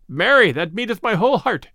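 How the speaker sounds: a stranger's eager cry, lightly voiced; hearty cry. A narrator's reading. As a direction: cry it out with some gusto. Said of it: "cried the stranger".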